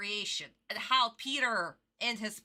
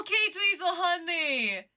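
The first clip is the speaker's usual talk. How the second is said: sweet talk; 8 semitones higher, 5 dB louder than usual. Real, not acted. Sweet goodbye to a stream viewer.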